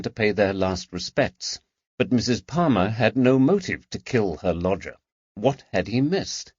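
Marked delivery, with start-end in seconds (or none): none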